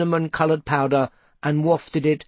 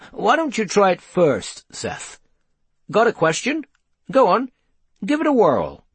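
high, lively coaxing; brisk encouragement; 8 semitones higher than usual. A narrator's reading. Pewter invites Seth to try the teleglobe, encouragingly. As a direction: egg someone on to have a go.

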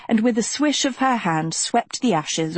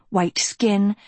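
ordinary delivery, nothing marked

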